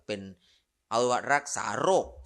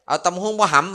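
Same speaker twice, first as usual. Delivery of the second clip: loud, higher street-vendor call; calling out to a passer-by; 8 semitones higher, 10 dB louder than usual. Retold or imitated. Monk retelling a papaya-salad vendor calling him, loud hawker's call. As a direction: holler it across the road at a passer-by.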